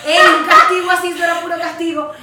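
loud exasperated insistence to her co-host; emphatic outburst. Real, not acted. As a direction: nearly shout it. Emphatic outburst about self-punishment.

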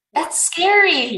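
high, loud, drawn-out reaction; real alarm. Real, not acted. Reader reacts to the grim story fact, alarmed. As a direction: stretch it out in disbelief.

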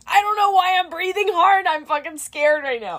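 high, loud panicked outburst; acted panic. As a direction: imitate yourself blurting it out in a fright. Acts out her frightened outburst.

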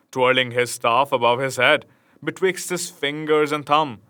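usual speaking voice

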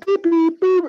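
high, clipped beep beep; high mock dial tones; imitated dial beeps. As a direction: mimic phone keys being dialled.

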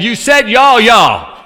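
loud, high accusing shout; comic indignant outburst; mock exasperated retort. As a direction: shout it back in mock disbelief.

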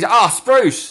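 high-pitched mock cry of annoyance; exclamation. Shown as a demonstration. Host trying out the new slang, exclaimed high. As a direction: exclaim it like a swear word. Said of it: "it's like an exclamation".

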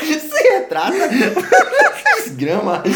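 cracking up with high laughs; laughing. Real, not acted. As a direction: laugh through the words. Laughing at friend's remark.

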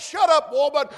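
loud, hammed-up retort; mock retort. Job retorting to his wife, loud mock anger. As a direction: snap back at his wife.

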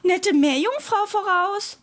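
high, sustained lookout call; monkey calling out. A narrator's reading. Monkey spotting a mermaid, calling out. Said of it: "rief der Affe".

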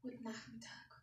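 barely audible whisper; whispered greeting. Real, not acted. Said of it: "Du musst lauter reden".

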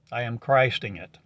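ordinary delivery

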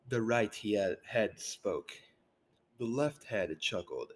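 ordinary delivery

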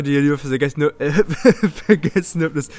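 laughing at his own slip; giggling through the words. Real, not acted. Fumbles the outro line laughing.

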